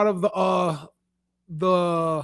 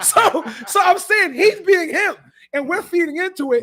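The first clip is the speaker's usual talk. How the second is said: laughing; 6 semitones higher, 4 dB louder than usual. Real, not acted. Laughing while arguing.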